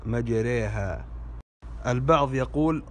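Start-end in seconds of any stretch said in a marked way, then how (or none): 0.1-1.1 "مجريها" slowly as majreha; slow "مجريها" with a long e; tilted alif. Shown as a demonstration. Teacher repeats the corrected word, "مجريها" slowly as majreha. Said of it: "والصحيح".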